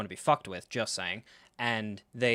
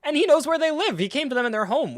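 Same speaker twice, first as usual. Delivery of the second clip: high, raised-voice insistence; exasperated rant; 13 semitones higher, 6 dB louder than usual. Real, not acted. Fan arguing that Iroh found their home, exasperated. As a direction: argue it in loud disbelief. Worked-up rant about Iroh's arrival.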